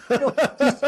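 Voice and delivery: laughing. Real, not acted. Laughing at own story.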